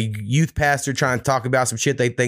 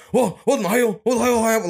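high, overblown reality-TV outrage; reality show dad; 10 semitones higher than usual. Retold or imitated. Dad overreacting to son's new tattoo, hammed-up reality TV shock. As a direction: ham it up like a reality show blowup. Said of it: "someone off a reality show".

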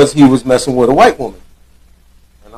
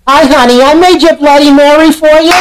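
high, sugary Bridge and Tunnel Jersey waitress; a waitress cooing over a Bloody Mary, high Jersey voice; 11 semitones higher than usual. Acted. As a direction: serve the drink as a sweet Jersey diner waitress, much higher. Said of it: "my waitress sounds Bridge and Tunnel Jersey".

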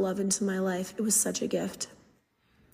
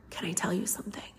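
whispered secret, much softer; child's whisper; 2 semitones lower, 11 dB quieter than usual. Retold or imitated. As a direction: lean in and whisper it like a shy kid. Child confides at the end.

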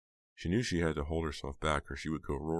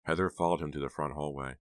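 ordinary delivery, nothing marked

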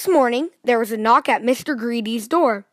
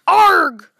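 loud, high "arg" of disgust; disgusted yell; 6 semitones higher, 7 dB louder than usual. A narrator's reading. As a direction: cry out at a horrible mouthful. Disgust at a mouthful of sawdust.

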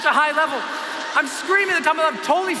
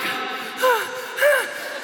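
sharp high gasp of fear; panicked gasp; 11 semitones higher than usual. Retold or imitated. Jumper at the open door on the count, sharp gasp. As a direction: suck in air in terror.

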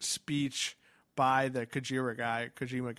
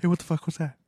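mock ghost hunter; 5 dB louder, faster than usual. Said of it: "That's how all of those shows go".

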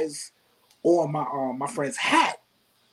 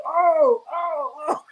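crowd reacting; 9 semitones higher, much slower than usual. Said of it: "you can see the crowd reacting".

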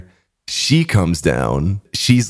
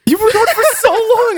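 much higher, louder incredulous cry; mock disbelief; 3 dB louder than usual. Friends refusing the bathroom excuse, high incredulous cry. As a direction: shriek it in outraged disbelief.

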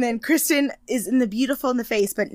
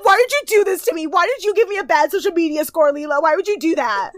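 higher, louder whiny blame; mock whining; 7 semitones higher, 5 dB louder than usual. Mocking Kristen blaming Leela, high and whiny. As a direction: whine like the victim.

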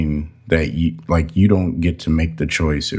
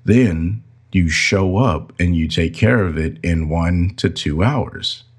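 usual speaking voice

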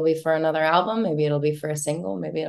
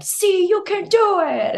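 much higher, slow, sing-song; cheering encouragement; 3 dB louder than usual. Retold or imitated. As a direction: cheer her on like a proud friend. Friends cheering her high note.

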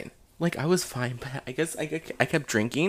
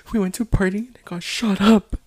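higher, tearful retelling; mock crying; 5 semitones higher, 4 dB louder than usual. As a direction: act out breaking down on the phone. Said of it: "I started crying".

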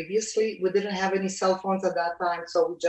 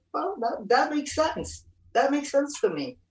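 surprised agreement; 7 semitones higher than usual. Retold or imitated. Retold reply to the lady.

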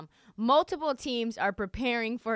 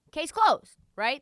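sarcastic; 9 semitones higher, 9 dB louder, slower than usual. Real, not acted. Sarcastic wrap-up.

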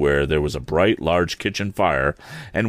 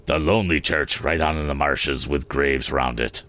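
low, brooding and menacing; low looming threat; 5 semitones lower than usual. Acted. Stranger names the marsh church.

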